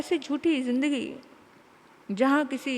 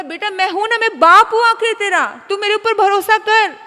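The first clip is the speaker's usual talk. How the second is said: loud insistence; 9 semitones higher, 11 dB louder than usual. Acted. Father insisting loudly.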